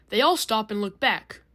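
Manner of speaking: plain reading voice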